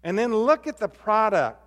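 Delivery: ordinary talk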